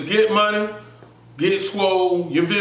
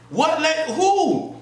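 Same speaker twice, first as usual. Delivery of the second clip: confused surprise; 10 semitones higher, slower than usual. Retold or imitated. Confused at accusation.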